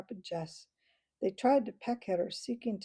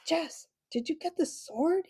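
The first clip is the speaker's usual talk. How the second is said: panted; 9 semitones higher, 3 dB louder than usual. Acted. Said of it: "he panted".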